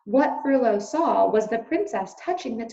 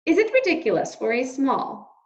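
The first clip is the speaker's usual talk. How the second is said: usual speaking voice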